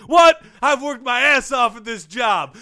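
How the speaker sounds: high, loud outraged protest; untethered Romeo. Acted. Fired coach protesting to his boss, loud and outraged. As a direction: burst out indignant at being sacked. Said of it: "he got a little untethered there".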